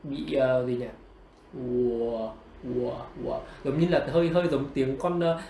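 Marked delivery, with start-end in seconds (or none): 1.6-3.4 low, soft "ùa" like a croaking toad; toad-like W glide. Shown as a demonstration. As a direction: croak out "ùa" from a rounded u. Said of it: "con cóc nó kêu ấy". (đọc "ùa" trầm như cóc kêu)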